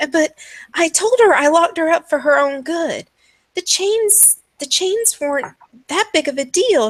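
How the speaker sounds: high, agitated mother justifying herself; defensive madwoman. Acted. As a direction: insist in a high, stammering madwoman's voice. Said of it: "she's like".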